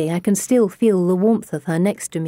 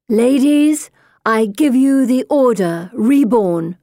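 loud, slow, ceremonial announcement; grand proclamation; 5 semitones higher, 5 dB louder, slower than usual. A narrator's reading. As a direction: proclaim it to the whole group.

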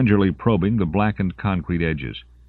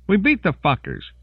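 much higher, cracking triumph; hysterical edge. A narrator's reading. Kibbo gloating over the dead aliens, hysterical. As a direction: let the glee tip into hysteria. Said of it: "Kibbo's voice had a hysterical edge".

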